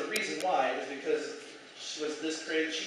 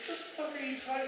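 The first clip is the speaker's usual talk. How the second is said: incredulous; 9 semitones higher, 7 dB quieter, faster than usual. Retold or imitated. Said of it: "And I was just like".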